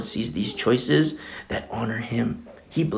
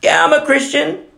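glib claim; 5 dB louder than usual. Retold or imitated. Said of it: "Where's the transformation?".

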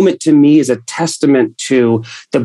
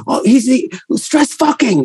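mock crew voice; 10 semitones higher than usual. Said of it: "They're like".

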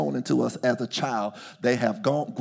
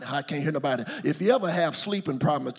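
ordinary talk, nothing marked

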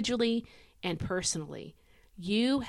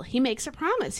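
ordinary talk

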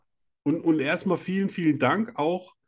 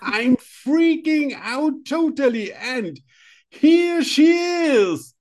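very high, drawn-out, loud announcement; hammed-up freak-out, building to a stretched cry; mock Oscar ecstasy; 13 semitones higher, 6 dB louder, much slower than usual. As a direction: present the star in over-the-top rapture. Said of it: "das ist meine erste Oscar-Rede".

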